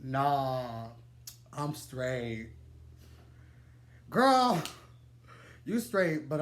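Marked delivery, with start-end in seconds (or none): 0.0-2.5 low, flat "nah, I'm straight"; deep mock guy voice. Boyfriend brushing her off, low and cool. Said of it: "just goes like". 4.1-4.6 sassy. Real, not acted. Mocking the man to the audience, sassy and loud.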